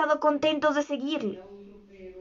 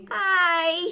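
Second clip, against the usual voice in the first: higher, sighing "ay" of sorrow; mournful lament; 5 semitones higher than usual. A narrator's reading. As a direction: lament it as a sad onlooker.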